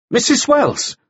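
flustered, high-pitched blurt, searching for any name; a nervous man grabbing at an answer; grasping wildly. A narrator's reading. Nielsen cornered by the jailer, blurting a name in a high flustered voice. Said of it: "Nielsen cast about wildly".

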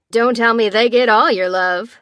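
loud, teasing DJ on the mic; playful DJ. A narrator's reading. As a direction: tease the room like a playful DJ. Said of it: "added the D J playfully".

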